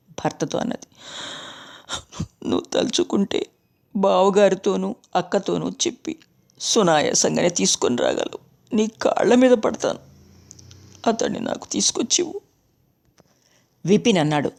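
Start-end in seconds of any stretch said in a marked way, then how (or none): 2.5-10.0 lightly voiced wife begging her husband; plead, rising into begging; a touch of tearful begging. A narrator's reading. 11.1-12.4 higher, softer begging wife; a wife begging her husband, softly and high; begging plea. A narrator's reading.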